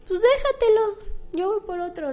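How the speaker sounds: a breezy monk handing over the bread; light unbothered reply. Acted.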